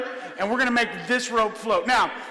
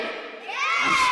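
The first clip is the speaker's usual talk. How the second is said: mock outrage. Mock offended outburst.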